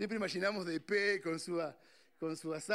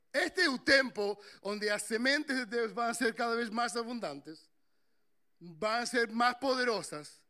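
louder, higher preaching climax; preaching at full force; 5 semitones higher, 5 dB louder than usual. Acted. Pastor urges the young people, rising full-force preaching. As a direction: build it up like a revival preacher.

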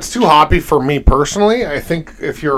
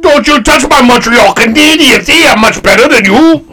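loud, high mock Montreal Canadian accent; comic Montreal accent, building to a high yell; 8 semitones higher, 7 dB louder than usual. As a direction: shout it in a hammed-up Montreal French-Canadian accent.

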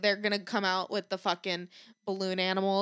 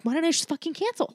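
higher frustrated outburst; higher mock fed-up question; 7 semitones higher than usual. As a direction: snap at yourself. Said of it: "I'm like".

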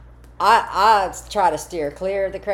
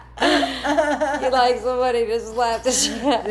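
laughing; 7 semitones higher, 3 dB louder than usual. Real, not acted. Friend cracks up repeating.

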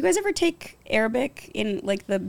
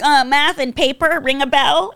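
sarcastic; 6 semitones higher, 5 dB louder than usual. Retold or imitated. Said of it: "I'm like".

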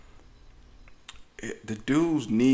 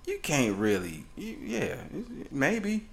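usual speaking voice

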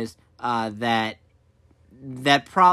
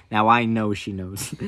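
ordinary talk

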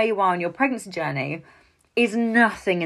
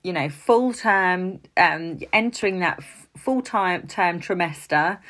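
ordinary talk, nothing marked

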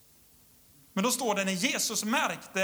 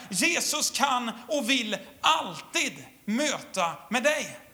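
full-force preaching on Jesus' power; rousing preaching; 4 semitones higher than usual. Acted. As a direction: drive the promise home to the church. Preaching climax to congregation.

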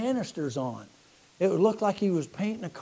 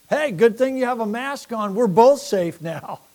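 louder, playfully sarcastic, slowing at the end; mock cheerful greeting; 6 semitones higher, 8 dB louder than usual. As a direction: tease the mask wearer with fake cheer. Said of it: "you know what I say?".

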